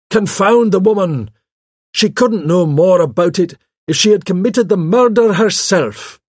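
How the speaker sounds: loud, high, indignant exclamation; blustering irritation at full voice; exasperated outburst. Acted. Melchett's outburst about Miss Marple.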